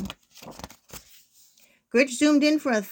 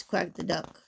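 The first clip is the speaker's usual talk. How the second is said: ordinary delivery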